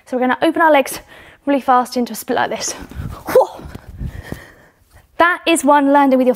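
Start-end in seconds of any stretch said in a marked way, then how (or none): 2.7-3.5 a breathy whew, blown out after the jump. Real, not acted. Coach landing a jump in front of the class, breathy whew. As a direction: puff out a quick breath of effort.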